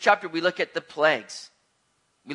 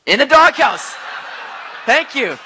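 loud joking call-out; 7 semitones higher, 8 dB louder than usual. Real, not acted. Joke about his wife.

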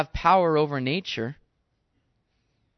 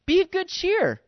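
Be of good cheer, pitched high and a bit louder; urgent encouragement; 12 semitones higher, 4 dB louder than usual. Retold or imitated. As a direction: cheer them on with a lifted voice. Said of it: "He's kinda going".

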